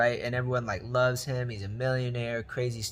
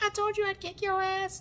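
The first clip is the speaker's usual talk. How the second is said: squeaky falsetto little creature; comic high-pitched threat; tiny shrimp taunt. Retold or imitated. Voices the little shrimp taunting Don.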